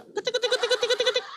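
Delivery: high, soft rapid tick-tick patter; cartoon insect noise; cockroach scuttling. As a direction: imitate tiny scurrying legs in a squeaky voice. Imitated patter as God becomes cockroach.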